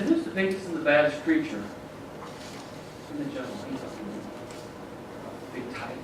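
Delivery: quietly sizing up the jungle; musing lion. A narrator's reading. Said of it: "So he said".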